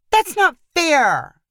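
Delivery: high, drawn-out protest; arguing. A narrator's reading. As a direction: object indignantly, stretching the words slowly. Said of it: "Cassidy argued".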